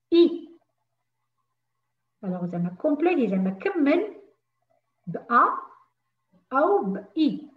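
ordinary delivery

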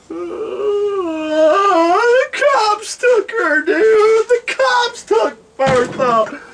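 high wailing blubber; mock crying. [name] explaining Bertha is his bong, sobbing. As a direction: sob it out like losing a loved one.

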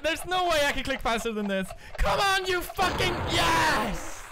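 high-pitched, straining urgency; frantic yelling. Real, not acted. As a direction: yell through a frantic clicking race. Frantic speed-clicking, then success.

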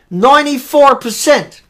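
usual speaking voice

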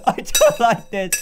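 usual speaking voice

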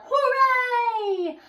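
high loud monster cheer; gleeful high cheer; cheering roar. Acted. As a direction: shout hooray with joy. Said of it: "all wave and roar".

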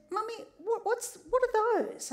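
put-on high little boy asking; piping child's question. Mother retells child's question.

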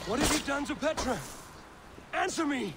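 shouted, fast and furious; berserk rage. Acted. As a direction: demand it in a fury. Eivor interrogating in rage.

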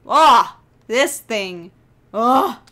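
loud frustrated groans; exasperated outburst. Real, not acted. Player hates this thing, loud exasperated outburst. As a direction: burst out loudly in disgust.

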